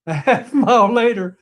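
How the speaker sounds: amused repeat with a laugh. Real, not acted. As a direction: chuckle through a half mile later.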